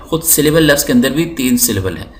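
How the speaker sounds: normal talking voice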